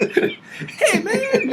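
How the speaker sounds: very high, laughing squeal; mock echo. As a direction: squeak out a playful echo of the greeting. Echoes the other's accent bit.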